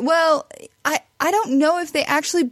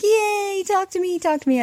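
high, gushing imitation of her own delight; excited squeal; 8 semitones higher than usual. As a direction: replay the giddy fan moment, up high. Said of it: "I was just like".

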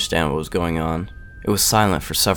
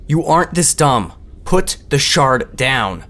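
high, loud urgent order; commanding; 8 semitones higher, 5 dB louder, slower than usual. A narrator's reading. Boy stops girl hurting herself, commanding raised voice. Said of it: "James's voice commanded".

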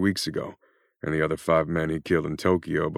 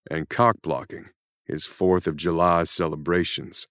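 plain reading voice

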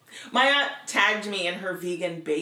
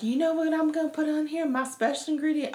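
put-on little girl voice; 7 semitones higher than usual. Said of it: "she's like".

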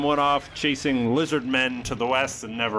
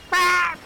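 high loud bird squawk; short squawk; parrot screech; 5 dB louder than usual. Acted. Parrot squawk after line.

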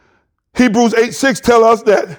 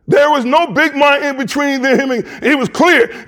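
ordinary delivery

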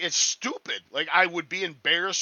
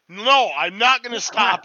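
playful loud refusal; 7 semitones higher, 10 dB louder than usual. Real, not acted. Teasing, refuses Megan's "stop it.

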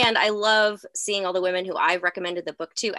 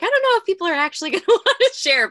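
laughing; 12 semitones higher, 7 dB louder, faster than usual. Retold or imitated. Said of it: "I was like".

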